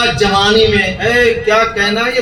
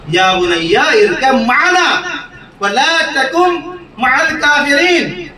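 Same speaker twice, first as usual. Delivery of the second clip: slow, rising recitation; Quranic recitation; 6 semitones higher, much slower than usual. Shown as a demonstration. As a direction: chant the verse slowly, building. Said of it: "جملہ دیکھیے گا". (آہستہ بلند ہوتی تلاوت)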